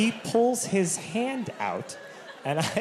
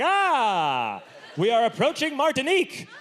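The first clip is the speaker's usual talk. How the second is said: grand announcement; 7 semitones higher than usual. Pretend. Pretending to lean over a cruise ship rail, mock-grand. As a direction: proclaim it like a hammy cruise passenger.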